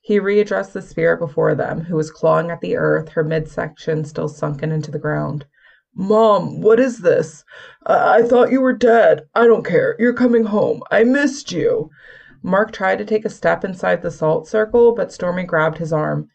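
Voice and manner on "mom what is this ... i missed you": higher, louder desperate pleading; desperate plea. Acted. A son begging his dead mother to come home, desperate. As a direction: beg her to come home, voice rising.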